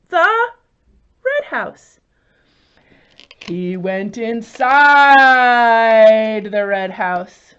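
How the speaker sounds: slow, drawn-out storybook reading; storytelling to children. Acted. Teacher modelling a mouse story for children, slow storybook voice. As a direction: build suspense toward the red house.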